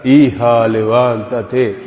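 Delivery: low, slow, solemn mock divine call; mock voice of God. Priest mocking a God who speaks from the clouds, low and slow. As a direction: boom it out like a heavenly voice from a cloud. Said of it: "y nos diga".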